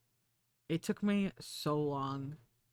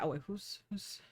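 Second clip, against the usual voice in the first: normal talking voice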